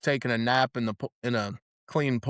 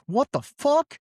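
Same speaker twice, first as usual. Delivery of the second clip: imitated shocked cry, much higher and a bit louder; shocked yelp; 4 dB louder, slower than usual. Friend looking up under the leather dress, high shocked yelp. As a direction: squeal it like you just saw too much. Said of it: "my friend looks up and goes".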